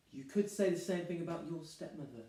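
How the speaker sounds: soft, understated comeback; muted retort. Acted. Groom countering bride about her stepmother, muted. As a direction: slip the objection in quietly.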